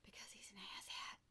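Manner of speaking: hushed whispered jab; whispered aside. Real, not acted. Girlfriend explains why she's upset with him, whispered.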